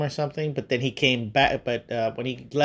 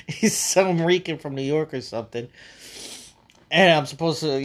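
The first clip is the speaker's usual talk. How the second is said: ordinary talk, nothing marked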